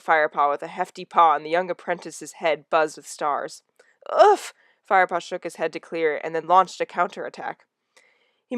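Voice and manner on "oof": winded oof. Acted. Head buzzed with stars.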